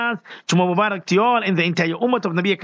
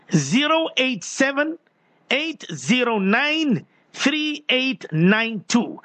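slow, measured number dictation, higher pitch; slow repeat; 3 semitones higher, slower than usual. Real, not acted. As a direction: read out each digit slowly for listeners writing it down. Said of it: "let me repeat myself again slowly".

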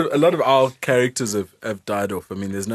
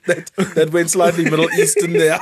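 laughing; 6 semitones higher, 7 dB louder than usual. Real, not acted. Laughing remark.

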